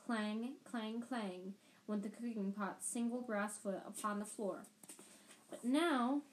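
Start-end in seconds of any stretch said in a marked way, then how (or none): none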